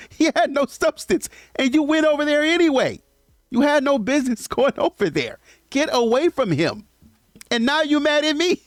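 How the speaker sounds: high-pitched, worked-up telling-off; exasperated rant. Real, not acted. Ranting at women who ignored warnings.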